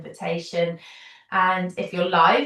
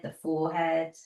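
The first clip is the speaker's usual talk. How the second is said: guided relaxation; 3 dB quieter than usual. Acted. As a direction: settle them gently into the body, unhurried. Leads a guided body relaxation.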